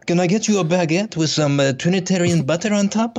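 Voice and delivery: mock French waiter, pitched high; French waiter accent. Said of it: "looking at this French restaurant".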